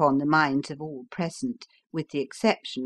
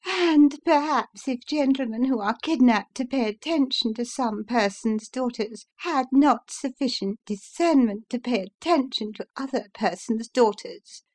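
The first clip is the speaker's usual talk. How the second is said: high, tart lady firing back; sharp retort; 8 semitones higher than usual. Acted. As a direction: return the insult, high and cutting. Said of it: "returned Missus Briggs".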